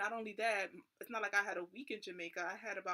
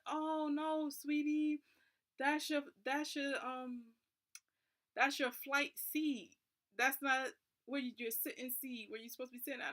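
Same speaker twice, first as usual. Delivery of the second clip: higher, louder, sugary airport worker; sweet airport lady; 6 semitones higher, 3 dB louder than usual. Retold or imitated. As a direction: coo it like a kind older lady calling someone honey.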